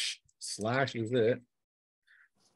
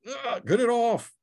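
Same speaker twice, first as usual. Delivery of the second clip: higher hammed-up yelp of alarm; mock panic; 10 semitones higher than usual. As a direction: flail at the bird on your back. GM voices hero attacked by bird.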